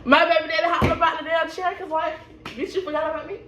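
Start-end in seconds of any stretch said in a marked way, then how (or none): 2.4-3.4 sassy jab. Retold or imitated. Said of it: "I'm looking at him like".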